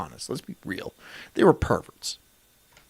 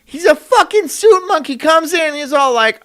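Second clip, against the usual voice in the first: normal talking voice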